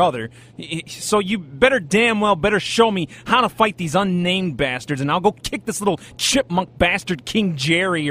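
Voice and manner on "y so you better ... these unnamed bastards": ordinary talk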